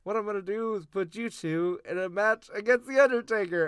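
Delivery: gleeful imitation of the GM making the match; Teddy Long impression. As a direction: announce it like a GM booking a match. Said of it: "And then he's like".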